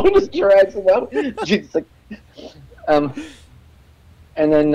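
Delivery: high, loud words through laughter; helpless laughing while narrating; laughing hard. Real, not acted. Guest recalling a heckler dragged out, laughing through the words.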